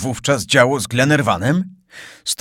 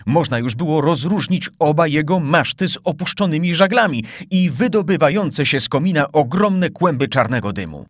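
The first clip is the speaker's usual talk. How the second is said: ordinary delivery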